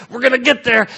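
high, urgent acted rallying; straining disciples. Disciples urging each other across the water, straining. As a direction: push it out high, as if paddling.

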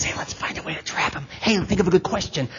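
hushed plotting, starting in a true whisper; conspiratorial whisper. Acted. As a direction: whisper it like schemers setting a trap. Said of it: "they were whispering about Jesus".

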